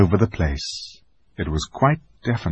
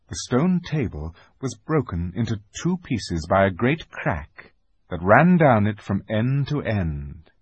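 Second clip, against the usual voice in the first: usual speaking voice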